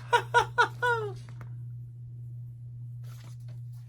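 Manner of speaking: airy high giggle; high breathy giggle. A narrator's reading. Shaggy giggling about the buffet, high and airy. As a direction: giggle like a silly cartoon. Laugh after Shaggy line.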